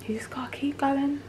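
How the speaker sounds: breathy high whisper. Real, not acted. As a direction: whisper it to yourself. Whispered in pouring rain.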